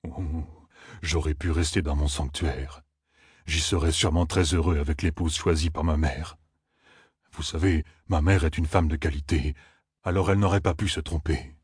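low, subdued self-pitying lament; woeful moaning. A narrator's reading. Loyal pines for his sanctuary, moaning gloomily. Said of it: "plissa les yeux et gémit".

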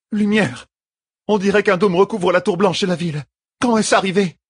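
high-pitched, alarmed exclamations; alarmed woman. A narrator's reading. Sister seeing a dome over the city, alarmed.

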